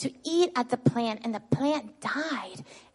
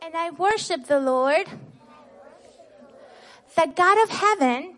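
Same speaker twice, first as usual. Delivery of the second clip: louder, higher leading call, each word set out clearly; call-out for children to repeat; 5 semitones higher, 5 dB louder than usual. Shown as a demonstration. Teacher calling the verse for children to echo back. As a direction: call the phrase out so the class can echo it. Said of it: "we're gonna say the Bible verse together".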